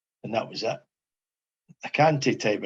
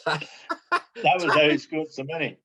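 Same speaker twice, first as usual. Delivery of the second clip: laughing; 5 semitones higher, slower than usual. Real, not acted. Laughing at his own story.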